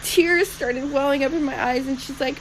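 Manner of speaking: usual speaking voice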